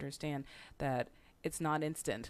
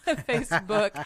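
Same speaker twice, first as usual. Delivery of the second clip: cracking up at your own stumble, higher and louder; laughing; 6 semitones higher, 13 dB louder, slower than usual. Real, not acted. Host laughs at tripping over Facebook, laughing loudly. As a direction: laugh the word out, slower and louder.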